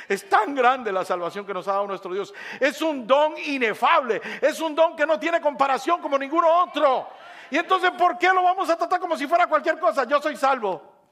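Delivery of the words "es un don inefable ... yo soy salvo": raised, high and loud pulpit voice; fervent shouted exhortation; full-force preaching. Real, not acted. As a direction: proclaim it with soaring force.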